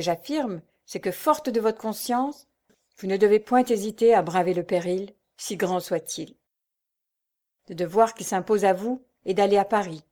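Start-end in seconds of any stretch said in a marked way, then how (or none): none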